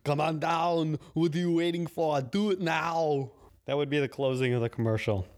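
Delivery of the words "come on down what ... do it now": a hint of a booming movie star; Arnold Schwarzenegger. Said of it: "all I want him to say is".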